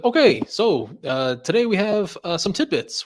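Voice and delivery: an even-keeled host easing into the topics; Matt Clausen impression. Streamer imitates Matt Clausen opening a video, high and slow. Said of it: "He's like".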